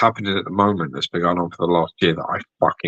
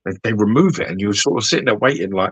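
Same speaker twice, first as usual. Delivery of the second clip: ordinary talk, nothing marked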